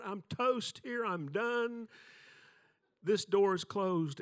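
high, loud, slow doom-laden wail; mock despair. Preacher retelling certain failure, loud high mock despair. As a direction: declare your own doom, hammed up. Retold inner panic on stage.

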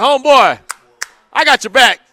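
loud hype call to the protester; cheering shout. Retold or imitated. Host cheering on the bag protester from home, loud shout. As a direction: shout your support from the couch. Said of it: "when I've been like".

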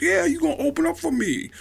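high, insistent mimicry; high comic celebrity voice; Tracy Morgan impression. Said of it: "your impression of him is spot on".

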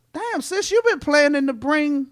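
high, lightly voiced aunt; mock aunt voice, amused; voicing the aunt. Aunt's retold thought, voiced high.

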